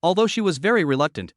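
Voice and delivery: ordinary delivery, nothing marked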